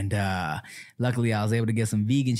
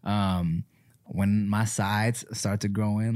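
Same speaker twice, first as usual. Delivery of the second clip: usual speaking voice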